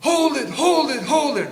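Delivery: loud, high, slow hallway bellow; bellowing yell. Retold or imitated. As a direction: bellow to stop the elevator. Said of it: "big bellowing yell from down the hallway".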